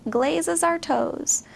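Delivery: usual speaking voice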